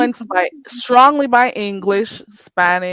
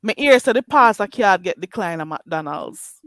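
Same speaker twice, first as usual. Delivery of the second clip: a native Jamaican patois line; 2 semitones lower than usual. Shown as a demonstration. Teacher demonstrating the whole patois sentence. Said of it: "let me say it this way".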